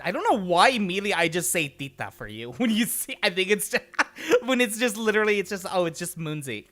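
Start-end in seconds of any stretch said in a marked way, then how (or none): none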